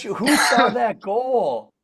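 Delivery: excited. Real, not acted. Excited teammate reacting to goal.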